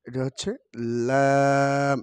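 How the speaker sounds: normal talking voice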